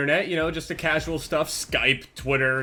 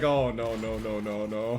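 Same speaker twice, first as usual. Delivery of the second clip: dread; 10 semitones lower, 7 dB quieter, slower than usual. Retold or imitated. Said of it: "And I was like".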